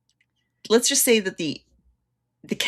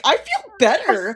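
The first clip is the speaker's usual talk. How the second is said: higher mock-relieved declaration; playful retold relief, pitched up; imitated Dan; 6 semitones higher, 4 dB louder than usual. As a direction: announce it like a hammy convert. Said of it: "Dan's like".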